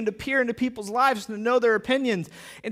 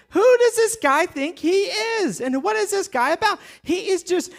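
high, mock-offended Pharisee voice; indignant grumbling; 9 semitones higher than usual. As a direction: carp about him in high-pitched disbelief. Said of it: "main things that they muttered about".

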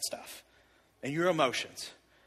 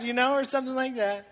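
ordinary talk, nothing marked

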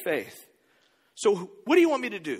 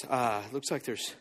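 sheepish mumble; 6 semitones lower, 6 dB quieter than usual. Acted. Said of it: "he goes".

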